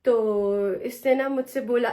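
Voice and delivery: normal talking voice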